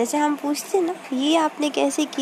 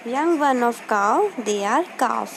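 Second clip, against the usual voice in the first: slow dictation; much slower than usual. Shown as a demonstration. Teacher dictating a question.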